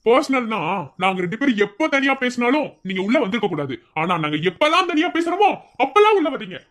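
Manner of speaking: high, rising indignant challenge; angry confrontation. Acted. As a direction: build to a heated accusation. Confronting the principal for intruding.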